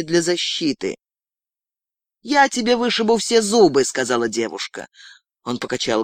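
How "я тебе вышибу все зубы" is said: high, hard threat; threatening. Acted. Girl warning her beaten captive, threatening. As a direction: menace him with a raised pitch.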